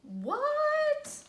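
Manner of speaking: shocked indignation at the insult; outraged what. Acted. Wolf hears his granny insulted, outraged disbelief.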